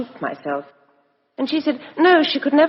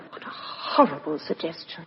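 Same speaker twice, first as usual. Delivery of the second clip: drops to a hushed, appalled murmur; shocked hush; 5 semitones lower, 9 dB quieter than usual. Acted. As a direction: recoil quietly at a horrible idea. Shocked by the idea of murder.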